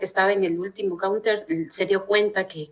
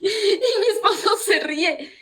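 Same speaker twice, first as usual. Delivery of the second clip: laughing; 10 semitones higher, slower than usual. Real, not acted. Laughing while recalling embarrassment.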